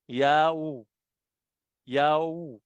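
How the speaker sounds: "Ya, U" drawn out into one gliding yah-oo; syllables blended. Shown as a demonstration. Teacher joins the letters aloud, "Ya, U" as a drawn-out yah-oo. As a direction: stretch "Ya, U" so the vowels join. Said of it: "Ano yung bigkas doon".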